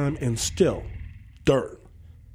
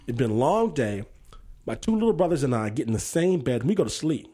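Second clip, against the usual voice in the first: usual speaking voice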